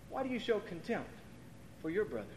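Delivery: quiet, piercing rebuke; hushed rebuke. Acted. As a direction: drop it to a near whisper. Question dropped to near hush.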